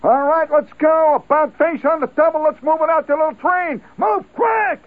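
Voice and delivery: high, loud barked orders; drill sergeant. Retold or imitated. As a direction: hurry the men like a frantic sergeant. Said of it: "the sergeant says".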